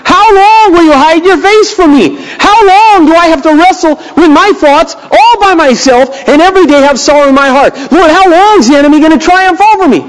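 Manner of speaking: high, pleading cry to God; anguished lament. Acted. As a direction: plead like David in despair. Reading David's lament.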